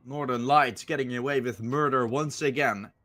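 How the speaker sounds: usual speaking voice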